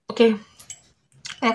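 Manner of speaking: ordinary talk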